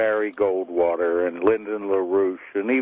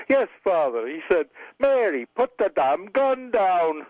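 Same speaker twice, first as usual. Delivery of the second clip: higher Irish widow, slowing on the punch line; Irish accent; 8 semitones higher than usual. Acted. Mary quoting her husband to the priest, Irish accent. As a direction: draw out the ending in Irish accent. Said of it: "you wanna hear an Irish joke".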